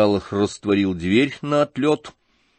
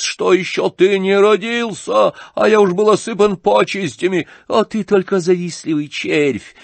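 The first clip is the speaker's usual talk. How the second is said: shouted tirade; 9 semitones higher, 5 dB louder than usual. A narrator's reading. General raging at the young atheist, shouted tirade. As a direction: rant with force, pitch held high. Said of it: "кричал генерал".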